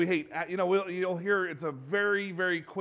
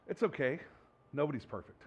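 soft, gentle, much quieter reassurance; comforting a child; 4 semitones lower, 7 dB quieter than usual. Retold or imitated. Parent consoling a child who messed up, soft and gentle. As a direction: soothe a kid who messed up. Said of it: "anybody ever say this line".

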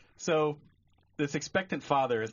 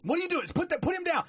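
panicked yell; faster than usual. Acted. Horrified father to doctor who dropped baby, panicked yell. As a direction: shriek it in horror at the doctor.

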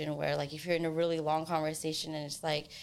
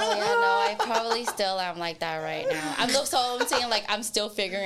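laughing; 5 semitones higher, 6 dB louder than usual. Real, not acted. Laughs at being teased.

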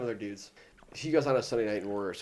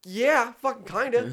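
mock high whiny answer; 12 semitones higher, 10 dB louder, slower than usual. Grumpy roommate at dawn.

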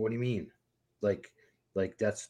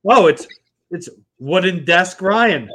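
loud announcement; 4 semitones higher, 7 dB louder than usual. Acted. Topping the nickname game loudly.

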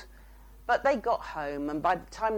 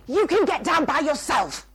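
high, loud, raging retort; mother's sudden rage, loud and high; retold angry snap; 9 semitones higher, 10 dB louder than usual. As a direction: snap it at the child in a fury. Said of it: "all Tay felt was rage".

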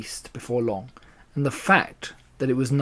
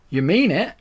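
higher, hopeful question; storybook badger, pitched up; astonished cry; 5 semitones higher, 4 dB louder than usual. A narrator's reading. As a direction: ask in amazed disbelief. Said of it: "cried Badger".

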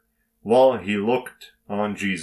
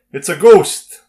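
loud, higher cry of terror; frightened scream; 7 semitones higher, 10 dB louder than usual. Acted. Disciples in the boat see someone walking on water, screamed. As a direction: call out in alarm, higher and much louder. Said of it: "they all screamed".

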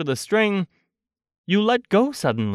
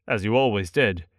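usual speaking voice

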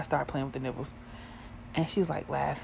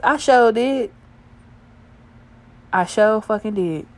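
loud, higher burst of owning up; emphatic admission; 4 semitones higher, 9 dB louder than usual. Real, not acted. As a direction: own it loudly and proudly. Owning up with gusto.

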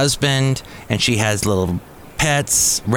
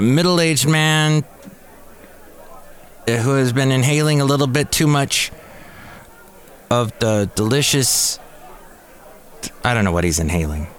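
ordinary talk, nothing marked

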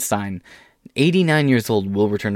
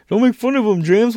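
much higher, louder scolding voice; scolding heckler voice; 11 semitones higher, 3 dB louder than usual. Pretend. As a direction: scold him as a pushy critic. Imagined heckler scolds him.

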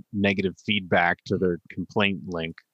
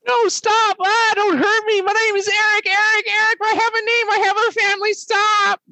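high, loud, panicked screams begging for mercy; screaming victim voice; 7 dB louder than usual. Acted. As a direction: shriek it like someone being attacked. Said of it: "That's a voice that I do a lot".

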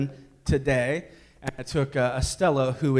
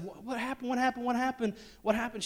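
panicked dad; 8 semitones higher, 7 dB quieter than usual. Retold or imitated.

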